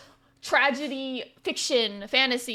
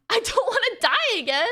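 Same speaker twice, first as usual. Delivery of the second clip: laughing; 9 semitones higher, 5 dB louder than usual. Real, not acted. Laughing dramatic plea.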